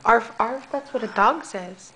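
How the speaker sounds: ordinary talk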